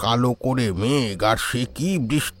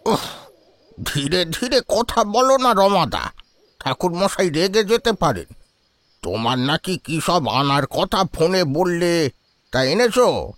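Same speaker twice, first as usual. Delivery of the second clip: put-on annoyed, higher-pitched old man; irritated; 7 semitones higher, slower than usual. A narrator's reading. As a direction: scold him with an exasperated huff. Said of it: "বিরক্তিসূচক শব্দ করে ঘুরে তাকালেন".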